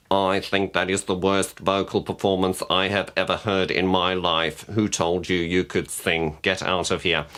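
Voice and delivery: robotic monotone judge; deadpan, mechanical judge impression; Simon Cowell. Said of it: "It's a little bit robotic".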